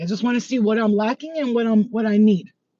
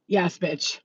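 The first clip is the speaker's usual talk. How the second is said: normal talking voice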